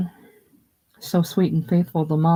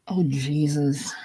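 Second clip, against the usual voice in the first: despairing; slower than usual. A narrator's reading. George despairing after dropping glass.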